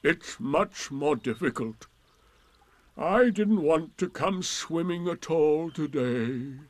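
lightly voiced grumbling donkey; slightly slow, self-important complaint; put-upon Eeyore. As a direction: explain with wounded dignity.

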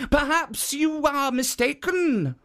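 high innocent guardian; high statue playing innocent. A narrator's reading. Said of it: "said Jitso".

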